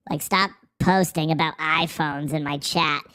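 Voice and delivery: ordinary talk, nothing marked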